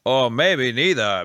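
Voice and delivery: ordinary talk, nothing marked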